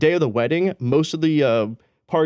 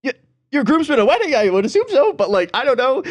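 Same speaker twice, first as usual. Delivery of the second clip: much higher, louder mock exasperation; mock exasperated reply; 10 semitones higher, 4 dB louder than usual. Telling a groomsman he needs a tie, high mock exasperation. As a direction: push it up high like it's obvious. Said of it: "I'm like".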